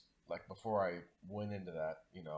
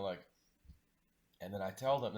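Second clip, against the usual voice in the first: ordinary talk, nothing marked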